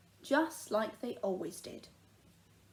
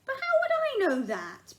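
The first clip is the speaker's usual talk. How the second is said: high, louder plea; girl pleading she couldn't know; 7 dB louder than usual. Acted. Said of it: "pleaded Mary".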